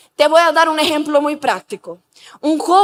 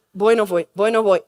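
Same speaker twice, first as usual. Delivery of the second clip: low, lightly voiced reluctant young man; joven indeciso; 7 semitones lower, 3 dB quieter than usual. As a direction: mutedly give in to temptation in a lower voice. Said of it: "Y el joven está así". (joven que cede, imitado en voz grave)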